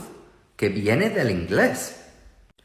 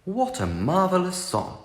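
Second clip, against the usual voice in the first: English accent; 6 semitones higher, 4 dB quieter than usual. Shown as a demonstration. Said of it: "No se dice".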